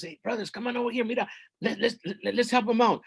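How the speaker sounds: high, hurried, stumbling summons; rally your brothers quickly; eager calling over. Acted. Ant calling his brothers to help, eager and high.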